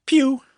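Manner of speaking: loud high pew blaster noise; pew sound effect. Acted. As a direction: fire a toy blaster. Laser tag shot sound.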